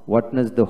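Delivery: ওয়েডনেসডে as "wed-nes-day"; wrong, d sounded. Shown as a demonstration. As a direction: sound every syllable of ওয়েডনেসডে, first d included. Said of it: "হবে না". (ডি সহ ওয়েড-নেস-ডে)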